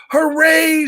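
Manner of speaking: a loud, high triumphant shout. Acted. Yertle cheering on his high throne, loud shout. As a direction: cheer it out from the top of the stack.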